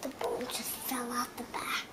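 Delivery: fast, soft whisper. Pretend. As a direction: whisper the bad news quickly. Pretend injury, whispered.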